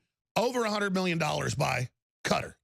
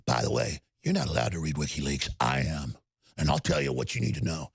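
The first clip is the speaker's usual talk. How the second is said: much lower, menacing mock anchor voice; host mocking Cuomo's threats on camera, menacing mock voice; threatening Cuomo. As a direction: growl it like a smug bully. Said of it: "so threatening when he ... goes".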